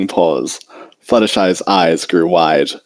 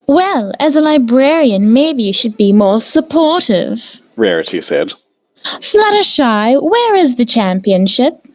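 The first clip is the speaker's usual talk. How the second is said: higher, refined lady's teasing voice; Rarity defending Fluttershy and teasing Twilight, refined and higher; genteel Rarity. A narrator's reading. Rarity chiding then asking.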